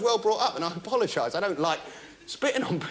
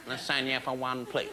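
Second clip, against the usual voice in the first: sheepish mumble; 9 semitones lower, 6 dB quieter than usual. Acted. Sad shopper asking at shop.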